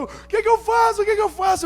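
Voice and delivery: high-pitched frantic pleading; imitating his distraught wife on the roadside; panicked wife.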